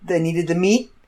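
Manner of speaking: usual speaking voice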